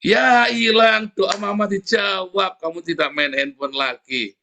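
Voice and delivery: slow, loud, cheerful mother's reply; lightly played amused mother; laughing it off. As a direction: drag it out, amused and unbothered. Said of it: "Atau ketawa".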